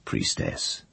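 low, hushed, almost breathed; a gentle, low word to a frightened girl; gently. Acted. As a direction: speak her title softly, to calm her. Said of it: "he said gently".

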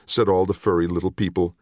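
ordinary delivery, nothing marked

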